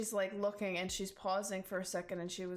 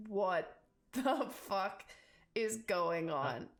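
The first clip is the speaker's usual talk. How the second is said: slow, stunned disbelief; slow crowd reaction, dropping at the end; baffled onlookers; slower than usual. Acted. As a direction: draw out each word in bewilderment. Stunned crowd's reaction, voiced slowly.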